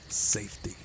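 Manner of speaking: plain reading voice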